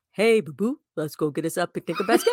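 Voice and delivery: fast cartoon bear impression. Shown as a demonstration. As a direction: play the picnic-loving bear. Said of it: "My bear, my bear sound is".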